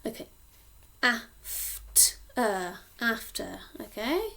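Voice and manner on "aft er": "Aft-er" said in two parts, Aft then er; split syllables. Shown as a demonstration. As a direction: sound out "Aft-er" slowly. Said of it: "I say after".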